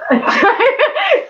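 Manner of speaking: a sudden squealing laugh, well above her voice; high burst of laughter. Real, not acted. Laughing to the chat about staring at models. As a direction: laugh out loud at the awkward memory.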